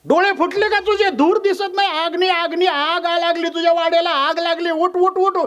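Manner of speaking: very high-pitched urgent hollering; acted frantic neighbour yelling a warning; clamouring alarm. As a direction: raise the fire alarm from across the road. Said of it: "इतका कालवा तिनं रोडच्या पलीकडनं केला".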